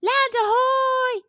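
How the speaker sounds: high, drawn-out squawking call; bird squawk. Acted. Snow petrel announcing land, high squawking call. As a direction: squawk it like a seabird. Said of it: "squawked the snow petrel".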